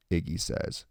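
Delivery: ordinary delivery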